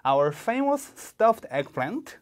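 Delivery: "famous" stressed with a rising tune; slow, clear model line for repeating; slow model reading. Shown as a demonstration. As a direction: stretch "Our famous stuffed eggplant" slowly for students to copy. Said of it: "하나씩 따라해 보실까요?".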